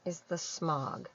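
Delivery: ordinary delivery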